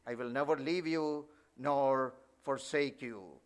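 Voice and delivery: soft, low and settled, never said gently; hushed, calm reading of the verse; quiet restatement. Shown as a demonstration. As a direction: let the promise fall quietly. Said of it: "Five times".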